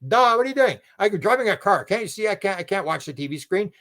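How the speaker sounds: lightly voiced irritated driver; exasperated retort. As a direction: snap back like it's obvious. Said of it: "It's like".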